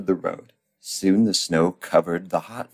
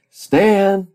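loud, high holler of a name; calling out; 14 semitones higher, 8 dB louder than usual. Acted. Pat calls to Stan, loud high shout. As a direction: holler it high to get attention. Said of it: "called Pat".

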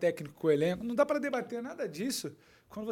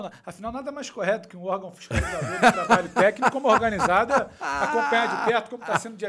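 sarcastic; 7 dB louder than usual. Real, not acted. Sarcastic praise of fan groups.

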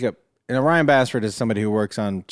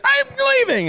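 high, indignant mock girlfriend voice; huffy girlfriend. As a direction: squeak it like an offended girlfriend. Imitating girlfriend storming out.

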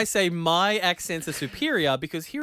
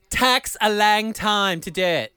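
mock Scottish; 5 semitones higher, 8 dB louder, slower than usual. Mimicking the Shetland baker.

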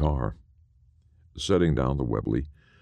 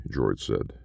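ordinary delivery, nothing marked

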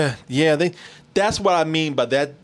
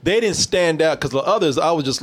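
ordinary delivery

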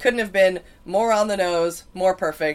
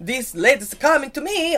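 higher, drawled mock drifter voice; drifter impression; 6 semitones higher than usual. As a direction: put on a goofy shirtless stranger. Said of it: "he was like".

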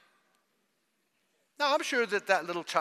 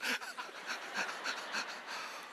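high breathy laugh; 9 dB quieter than usual. Real, not acted. Laughs at own mock.

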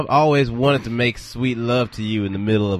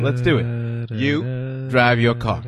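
slow; 3 semitones lower, much slower than usual. Acted. Robber plan, slow odd voice.

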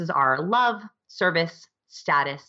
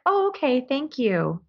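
much higher, a hint of a polite mild thanks; lightly imitated polite employee; 9 semitones higher, slower than usual. Employee mildly thanking her boss for the bonus, lightly imitated. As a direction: play it politely underwhelmed.

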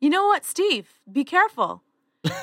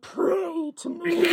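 very slow, soft, laughing mock Batman; hoarse, breaking Batman attempt; 4 semitones higher, 4 dB quieter, much slower than usual. Shown as a demonstration. [name] retries the Batman line, slow and laughing. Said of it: "Sounds like he just has laryngitis".

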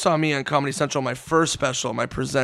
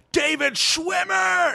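drawn-out, high announcer's cry; mock announcer shout; 14 semitones higher, much slower than usual. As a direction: imitate an emcee bringing on a star. Acted stage introduction of a celebrity.

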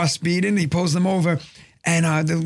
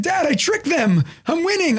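little son; 10 semitones higher than usual. Retold or imitated. Son to dad from third base, high imitated kid voice. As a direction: imitate a kid proudly calling out. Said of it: "He's like".

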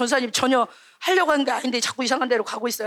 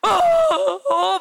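mock choked sob, drawn out; half-hearted sob from the teacher telling it; imitated sobbing; 3 semitones higher, 3 dB louder, much slower than usual. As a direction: act out a child starting to cry. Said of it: "얘네가 갑자기 막 울기 시작해".

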